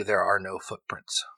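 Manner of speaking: hushed, unvoiced reveal; spooky whisper. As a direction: whisper it like a ghost story. GM reveals eerie clue.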